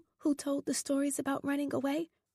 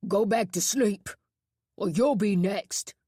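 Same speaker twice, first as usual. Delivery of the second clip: louder barked order to the dorm; shouted threat; 6 dB louder than usual. A narrator's reading. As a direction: shout it at the staring boys. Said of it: "Boone shouted".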